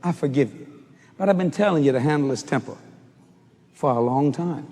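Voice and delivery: gentle voice of God. Acted. God answers the preacher.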